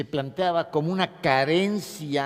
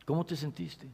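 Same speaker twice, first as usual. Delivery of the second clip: soft therapist question; 10 dB quieter than usual. Acted. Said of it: "Y la reconectas".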